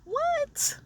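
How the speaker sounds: very high, disbelieving squeak; can't believe the crying again; baffled. Retold or imitated.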